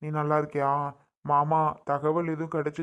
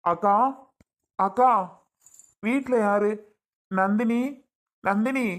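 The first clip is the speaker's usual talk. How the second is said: raised, higher voice calling into the house; 4 semitones higher, 4 dB louder than usual. Acted. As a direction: call out for whoever is home. Visitor calls into the house.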